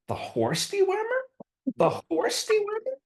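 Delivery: high, squeaky disbelief, repeated; incredulous shock. Retold or imitated. Woman at airport hears he's an ivermectin expert, shrieking disbelief. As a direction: she can't believe what she's hearing. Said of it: "she goes, she goes".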